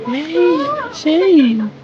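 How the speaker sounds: high, loud doggy greeting. Real, not acted. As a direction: call out sweetly to the pet. Greeting dog.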